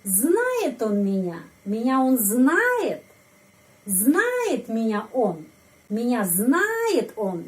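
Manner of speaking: slow question, lifting hard on знает; stress on знает. Shown as a demonstration. Teacher drills word orders as questions, stress on знает. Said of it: "Penekanan intonasi pada kata kerja".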